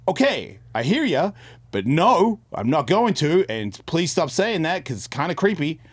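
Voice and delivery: exasperated plea. Retold or imitated. Pleading with the voice.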